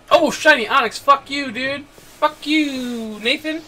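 high, loud joking outburst; mock anger. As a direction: curse a friend in playful outrage. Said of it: "I'm just kidding, man".

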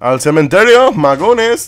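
proclámalo como un bufón; playful catchphrase voice. Pretend. (voz de broma, más aguda y fuerte)

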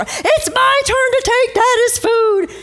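put-on high, drawn-out childish whine; high mock sibling protest; squabbling kid. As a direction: imitate a child fighting for a turn. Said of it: "Our kids would fight over".

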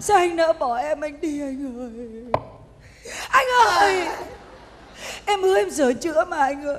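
loud hammed-up tearful pleading; mock pleading wail. Woman begs departed boyfriend, loud mock crying. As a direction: beg him back, overdone sobs. Fake crying after lover leaves.

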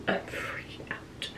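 slow, very soft panicked whisper; whispered freak-out. Real, not acted. Prankster confiding to viewers, whispered freak-out. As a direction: whisper your panic, drawing it out.

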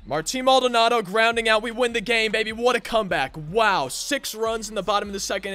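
loud, high winning call; victorious excitement. Real, not acted. Final out, game won.

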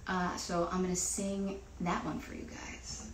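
ordinary delivery

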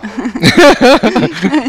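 loud, high burst of laughter; hearty laugh. Real, not acted. Interviewee cracking up in an interview, real hearty laugh.

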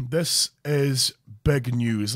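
ordinary talk, nothing marked

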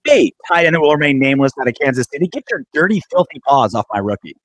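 playful fake outrage, fast; mock scolding. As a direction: scold him like a jealous coach. Mock scolding a rival player.

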